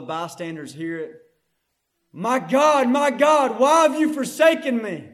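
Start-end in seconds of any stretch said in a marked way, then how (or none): 2.1-5.1 loud, higher-pitched cry of anguish, acted; Jesus. Preacher voicing Jesus on the cross, loud acted cry. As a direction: cry out to God so all the bystanders hear. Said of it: "In a loud voice, he screams".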